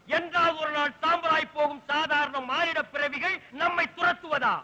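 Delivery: ordinary talk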